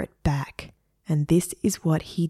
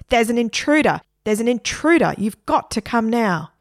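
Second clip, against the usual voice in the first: high, loud repeated yell; panicked shout; 5 semitones higher, 4 dB louder than usual. A narrator's reading. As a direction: scream for help down the phone. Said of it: "he shouted at the police".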